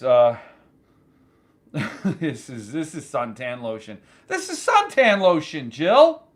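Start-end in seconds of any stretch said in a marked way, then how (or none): 2.7-4.0 lower, softer, amused realization; low aside. Real, not acted. Host opens the drink and sniggers, low and soft. First take, to himself. 4.3-6.2 raised voice, name rising at the end; incredulous callout. Real, not acted. Host confronts his producer over the drink, loudly incredulous. Repeats it, calling to Jill.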